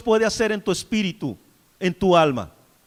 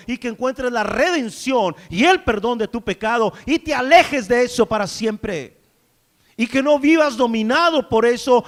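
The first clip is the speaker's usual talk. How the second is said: loud, driving sermon delivery; impassioned pulpit preaching; full-force preaching; 5 semitones higher, 7 dB louder than usual. Acted. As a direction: preach it hard to the congregation.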